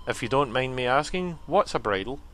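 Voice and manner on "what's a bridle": normal talking voice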